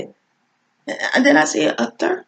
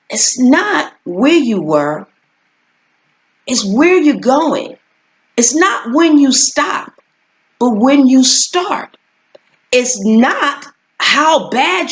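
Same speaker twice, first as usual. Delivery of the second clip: preaching; 6 semitones higher than usual. Acted. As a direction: preach it to the congregation. Preaching a lesson.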